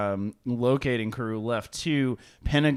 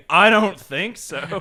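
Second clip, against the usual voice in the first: slow mock refusal; 6 semitones higher, 6 dB louder, slower than usual. Friend imitating blunt reply.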